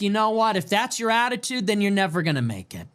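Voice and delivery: ordinary delivery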